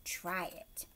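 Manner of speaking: soft whisper; hushed coaxing whisper; whispered invitation. Real, not acted. Inviting children to join.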